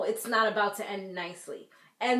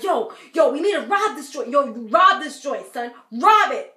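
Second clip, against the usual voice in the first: louder, mock hyped shout; imitating Puma yelling; 7 dB louder than usual. Retelling Puma yelling to rob the joint, mock shout. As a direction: ham up a hyped guy yelling to rob the place. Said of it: "And he's like".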